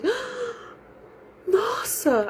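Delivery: high, breathy-soft gasp of realization; sudden realization. Retold or imitated. Mother realizing her son meant it kindly, surprised gasp. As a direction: act the penny dropping, pitch leaping up.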